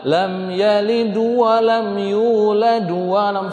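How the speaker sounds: high slow chant, "yalid" and "yulad" ending in u; wrong vowelled endings. Shown as a demonstration. Teacher showing a misheard reading, "yalid" chanted as "yalidu".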